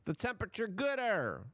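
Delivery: high, mocking voice of a clueless official; sneering parody of green policymakers; mocking dumb official. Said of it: "there's no bigger scam than saying".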